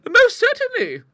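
very high, loud agreeing spinster; timid old lady voice. Acted. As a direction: chirp your agreement like an elderly lady. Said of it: "acquiesced her sister".

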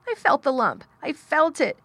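high, loud, tearful wail; choked out. A narrator's reading. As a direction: choke it out through sobs. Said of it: "choked out Colin".

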